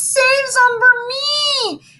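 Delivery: high, slow wailing plea; crying plea. Acted. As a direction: beg through tears. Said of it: "Ham cried again and again".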